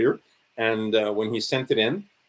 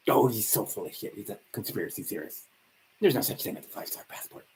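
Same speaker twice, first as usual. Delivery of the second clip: smug dismissal; 3 semitones lower, 6 dB quieter than usual. Retold or imitated.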